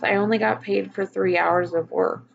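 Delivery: ordinary delivery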